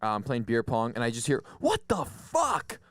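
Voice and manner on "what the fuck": high drawn-out mock yell; girl's high slow shocked shriek; retold yell. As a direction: play the girl shrieking in shock. Girl yelling at party, retold.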